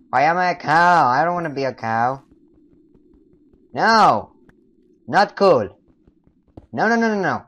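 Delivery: high, loud wails of refusal; loud protest. Pretend. Player refuses to be a cow, loud protest. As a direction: shout it in mock outrage.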